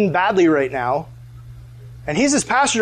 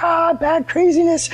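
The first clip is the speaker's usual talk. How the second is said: high, drawn-out comic wail; mock dismay; 10 semitones higher, slower than usual. Storyteller learning of the near embassy call, dismayed. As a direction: act out horrified relief at a narrow miss, slowly. Reaction to near-disaster email.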